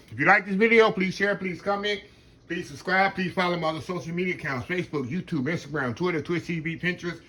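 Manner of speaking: cheerful channel outro call to action; upbeat outro plug. Acted. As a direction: rattle off the socials like a closing announcer.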